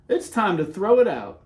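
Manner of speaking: usual speaking voice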